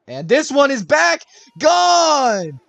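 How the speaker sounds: yelling high home run call; excited shouting. Real, not acted. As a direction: scream it out, game is tied. Home run ties the game.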